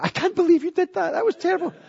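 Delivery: husband mimicking his wife's high indignant protest; imitating indignant wife. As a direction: squeak it like his appalled wife. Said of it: "I played it back to her".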